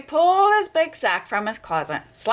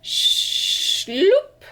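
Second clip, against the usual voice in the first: high sliding sound; 10 semitones higher than usual. A narrator's reading. Sack dragging sound.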